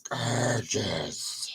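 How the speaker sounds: a deep echo repeating Gorgeous right after herself; double voice. Shown as a demonstration. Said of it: "that was my double voice".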